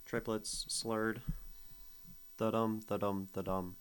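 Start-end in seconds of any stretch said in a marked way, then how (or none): 2.4-3.8 Da-dum with a lazy swung long-short; Da-dum, da-dum in swing time; swing feel. Shown as a demonstration. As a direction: swing the beat like slurred triplets. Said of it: "the swing feel".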